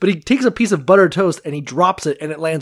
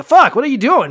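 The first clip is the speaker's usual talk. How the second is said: high, loud disbelief; baffled outburst; 10 semitones higher, 8 dB louder than usual. Acted. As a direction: demand an explanation, startled. Acting baffled family confronting nanny.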